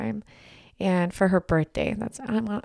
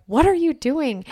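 puzzled wife; 7 semitones higher, 4 dB louder than usual. Retold or imitated. Wife quoted finding him odd.

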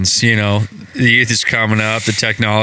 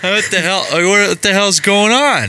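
mock baffled; retold bewilderment; 8 semitones higher than usual. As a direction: sound totally lost. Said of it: "I was like".

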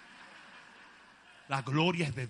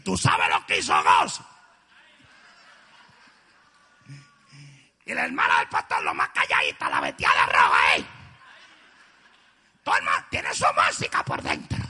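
much lower, loud put-on gossip voice; gossiping prophet; 12 semitones lower, 11 dB louder than usual. Preacher acting the prophet as a gossip, low and loud. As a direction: growl out the gossip comically.

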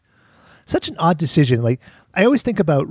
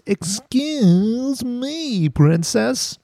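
drawn-out whiny-high catchphrase, stretched EXCUSE; long, high, exaggerated "excuse me"; Link; 11 semitones higher, much slower than usual. Host quoting the cartoon's catchphrase, mocking drawl. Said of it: "Link's famous catchphrase".

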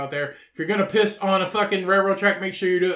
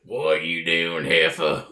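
low, slow greeting; low put-on voice; 12 semitones lower, much slower than usual. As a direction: drawl it out low. Imitating greeting relative.

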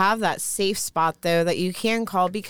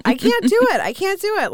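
high, squealing can't-do-it; real squeamish; 10 semitones higher than usual. Real, not acted. Watching something awkward, high squeamish protest. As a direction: squeal in half-joking dread. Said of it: "there's faces and there's names for a reason".

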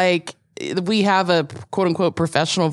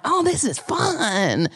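mock cheerful; 4 semitones higher, slower than usual. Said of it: "I'm like".

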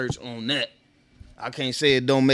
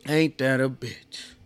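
normal talking voice

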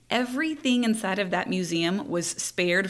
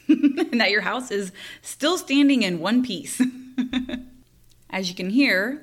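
usual speaking voice

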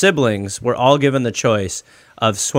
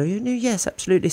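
higher, softer, a hint of a proper elocution English accent; 9 semitones higher, 6 dB quieter, faster than usual. Imitating his mother who took elocution, proper English accent. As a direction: lightly imitate a posh trained English accent. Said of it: "she has a very proper".

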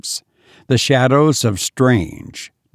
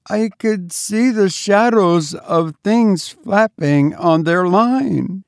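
high, faint, slowing voice; frightened maid; 8 semitones higher than usual. A narrator's reading. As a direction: recount it as if haunted. Terrified maid continues her account.

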